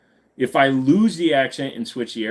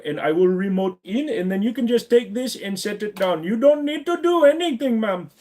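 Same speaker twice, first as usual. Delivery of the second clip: put-on Indian accent; 9 semitones higher than usual. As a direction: gush eager help in a singsong Indian accent. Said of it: "Rajesh, man ... I need some help".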